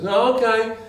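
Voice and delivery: high voiced rabbit asking for carrots; talking rabbit. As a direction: pipe up high like a tiny rabbit. Joke, rabbit gives up.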